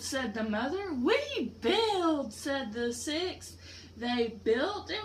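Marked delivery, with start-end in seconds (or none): 0.9-2.3 little beavers. Acted. Baby beavers answer mother.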